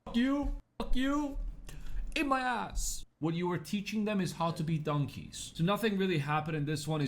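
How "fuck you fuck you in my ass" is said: high-pitched cartoonish taunt; mock defiant strutting. Cocky king struts naked with shoulders up, mock defiant. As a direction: squeal the insult, way up high. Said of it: "and go like".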